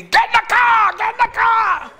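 high, loud laughing yell; hammed-up getaway panic; mock frantic shout. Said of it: "would you be like the lady".

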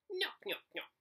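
very high, squeaky croaked nyok; Three Stooges style frog croak. Acted. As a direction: croak it like a cheeky tree frog. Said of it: "Mo croaked".

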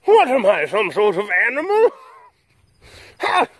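high, indignant mock outrage; cat voiced as haughty diva. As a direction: give the cat an offended, grand voice. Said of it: "she's like".

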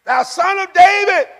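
high-pitched call over a crowd. Acted. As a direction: call out high to get past everybody's noise. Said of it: "He's trying to talk louder".

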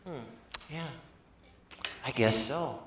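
hesitant feigned ignorance, in broken starts; playing dumb. Jesus answering the scolding disciples, playing dumb. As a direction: falter softly as if clueless. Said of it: "he acts like he's, you know, stupid".

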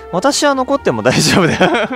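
Noah. Acted. Offering to stay behind at the game, acted high, easygoing voice.

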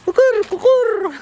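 high, slow crowing imitation for a toddler; rooster crow. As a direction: crow like a rooster to amuse the baby. (लहान मुलीसाठी कोंबड्याचा आवाज)

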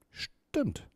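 hushed amazement, barely voiced; astonished. A narrator's reading. Mia confirms the owl read her thoughts, astonished. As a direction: let it out softly, struck with wonder. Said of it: "antwortete Mia erstaunt".

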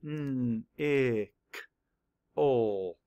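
"N, I, K, ol" as separate sounds, N hummed; sounded out. Shown as a demonstration. Teacher mapping the sounds of nickel, sounded out. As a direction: segment it: "nnn", short "ih", "k", "ol". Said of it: "Map the sounds".

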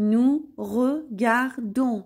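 "nous regardons" very slowly, a gap after each syllable. Shown as a demonstration. Teacher showing learners "nous regardons", syllable by syllable. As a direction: break "nous regardons" into separate, drawn-out syllables.

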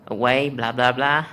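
ordinary delivery

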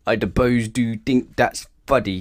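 slow, loud, bunged-up grumble; blocked-trunk voice. A narrator's reading. Sulking elephant with knotted trunk, slow and congested. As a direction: talk as if the nose is knotted shut. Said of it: "couldn't talk properly with a knot".

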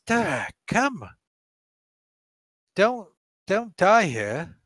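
a hint of a pleading elf, slowly; elf lady voice. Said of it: "she says".

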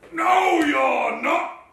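slow, loud troll bellow; roaring troll. A narrator's reading. Troll refuses the little goat passage, roaring. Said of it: "roared the troll".

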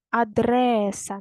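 Адреса with a long stressed РЕ; stress on second syllable. Shown as a demonstration. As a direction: stretch the middle syllable of Адреса, slowly. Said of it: "На другий склад падає наголос". (Адреса з протяжним наголосом на другому складі)

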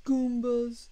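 loud hammy Goombas yell; loud Mario shout. Pretend. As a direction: belt out the word. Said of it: "I hope my neighbor can hear me".

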